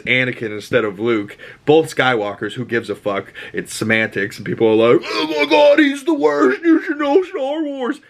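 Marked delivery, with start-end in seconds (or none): 5.0-8.0 very high-pitched screechy mocking voice; exaggerated squealed outrage, put on; mock outraged fan. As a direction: mimic a hysterical fan complaining. Mocking complaining fans.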